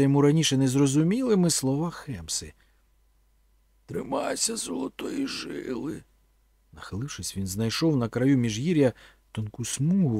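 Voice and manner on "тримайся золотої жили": high, soft, drawn-out voice from memory; slow, high, hushed echo of remembered words. A narrator's reading. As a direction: let the recalled advice float up slowly and softly. Recalled words of Khemsa echo in memory.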